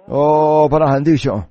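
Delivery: lightly voiced calling out; mild retold call. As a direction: imitate a caller, without really shouting. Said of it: "我听见有人叫喊说".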